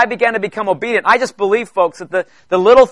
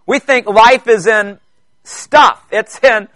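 preacher pleading with his congregation, building and slowing; building preaching; 3 semitones higher than usual. Real, not acted. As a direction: drive the point home, word by word.

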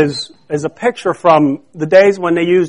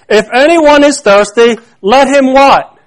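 raised, emphatic preaching voice; loud voice; 5 semitones higher, 4 dB louder than usual. Acted. Preacher quoting Jesus to the congregation, loud voice. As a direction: proclaim it loud and higher. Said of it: "said in a loud voice".

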